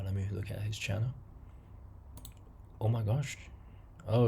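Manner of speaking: ordinary talk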